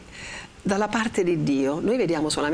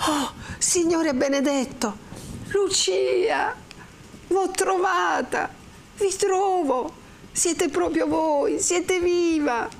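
high, slow cry of overjoyed disbelief; trembling exclamation; 11 semitones higher, much slower than usual. A narrator's reading. Renzo finds Lucia alive, crying out high and overcome. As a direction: cry out in amazement, drawing the words out. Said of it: "esclamò avanzandosi tutto tremante".

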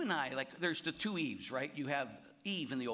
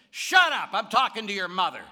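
put-on high, loud old lady retort; snappish shout back; 7 semitones higher, 14 dB louder than usual. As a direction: fire it back up at him, annoyed. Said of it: "turned around and looked up and said".

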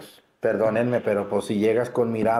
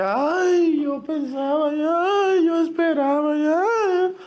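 very high, drawn-out fake wail; exaggerated tearful lament, held on one high note; mock crying; slower than usual. Priest mocking those who will come crying later, hammed-up wailing. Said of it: "no estén llorando y que".

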